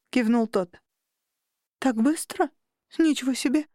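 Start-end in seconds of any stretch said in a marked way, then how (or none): 1.8-3.7 higher-pitched mild amazement; astonished little exclamation, voice raised; surprised. A narrator's reading. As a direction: react with a lift of disbelief. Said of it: "Я удивлённо покачала головой".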